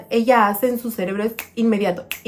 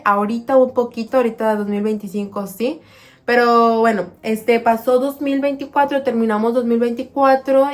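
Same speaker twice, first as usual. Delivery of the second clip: ordinary delivery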